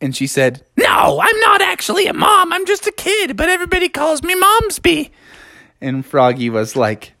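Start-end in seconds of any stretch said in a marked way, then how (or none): 0.8-5.1 put-on very high, squawky frog girl; crazy old-person voice. A narrator's reading. Girl frog corrects Froggy, crazy old-person voice. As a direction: explain it in a silly squawk. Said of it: "you still talk in that s-, crazy voice".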